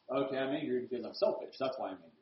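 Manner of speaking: low, very soft confession; acted grudging realization, dropping away; subdued admission. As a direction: own up quietly, as if to yourself. Acts child's reluctant realization.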